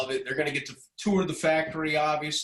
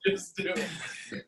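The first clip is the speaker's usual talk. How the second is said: breathy laughing echo; 4 semitones higher, 4 dB quieter, much slower than usual. Retold or imitated. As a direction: laugh it out softly. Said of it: "You sound like".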